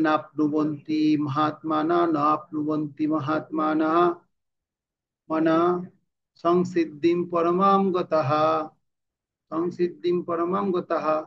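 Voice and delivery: measured recitation, full "haa" closing গতাহা; verse pronunciation demo. Shown as a demonstration. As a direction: recite evenly, মহাত্মানা light at the end and গতাহা full. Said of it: "বিসর্গ থাকলে পুনঃ উচ্চারণ হবে". (গতাহা শেষে পূর্ণ হ দিয়ে আবৃত্তি)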